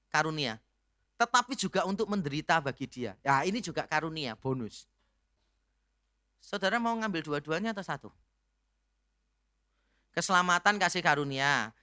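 ordinary talk

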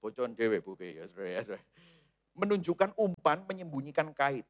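usual speaking voice

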